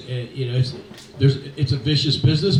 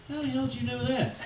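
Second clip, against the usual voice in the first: pitched way up, incredulous; astonished disbelief; 12 semitones higher, 8 dB quieter than usual. Retold or imitated. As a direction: retell a baffled broadcaster's amazement, high and light. Astonished broadcaster asks how.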